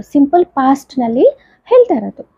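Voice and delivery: ordinary delivery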